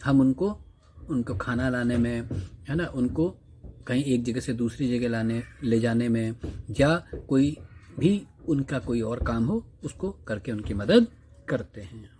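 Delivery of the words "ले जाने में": normal talking voice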